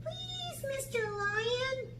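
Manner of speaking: put-on little girl's soft plea; timid whisper. A narrator's reading. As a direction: shrink back and ask softly. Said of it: "whispered Sarah".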